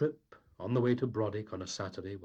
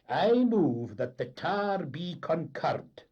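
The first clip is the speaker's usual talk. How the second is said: high, loud formal motion; pompous Doogie; 9 semitones higher, 7 dB louder, slower than usual. Acted. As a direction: announce it grandly.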